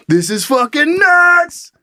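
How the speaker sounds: high, slow, stretched-out exclamation; mock panicked amazement. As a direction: draw it out as the chaos swallows you. Diner overwhelmed by rising foam.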